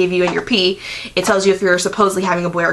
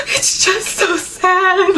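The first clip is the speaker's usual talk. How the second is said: mock sob; 11 semitones higher, much slower than usual. Imitating her crying over the film.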